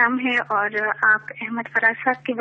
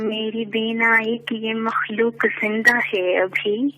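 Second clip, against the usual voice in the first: measured poetic recitation, lower; stately nazm recitation; 4 semitones lower, 4 dB quieter than usual. Acted. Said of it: "ایک نظم پیش کرنا چاہوں گی".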